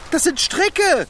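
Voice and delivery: alarmed realisation. Acted. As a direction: suddenly realise you're tied up. Merkt, dass er gefesselt ist. (erschrockene Erkenntnis)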